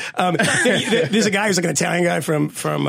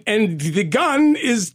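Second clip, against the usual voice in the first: ordinary delivery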